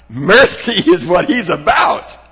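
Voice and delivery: loud preacher's exclamation; emphatic shout. Real, not acted. Preacher telling congregation what Jesus is about, emphatic shout. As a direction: shout the point out.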